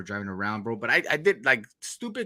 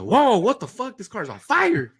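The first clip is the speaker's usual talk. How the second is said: high, loud startled yell; shocked shout; 13 semitones higher, 10 dB louder, slower than usual. Retold or imitated. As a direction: blurt it out in sudden shock. Discovering car on fire.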